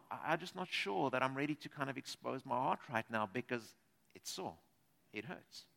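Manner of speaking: low, soft voicing of a sore heart; hurt hushed voice. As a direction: let it sink into a hurt hush.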